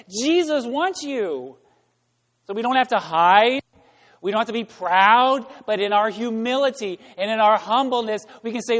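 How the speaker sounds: preaching. Acted. Pastor preaching brokenness to the congregation, emphatic. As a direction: hammer each line home.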